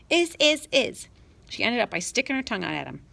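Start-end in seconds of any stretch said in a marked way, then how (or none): 0.1-1.1 put-on very high, loud little girl retort; shrill child retort. Little sister arguing with her brother about the dog. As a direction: snap back like a bratty little girl.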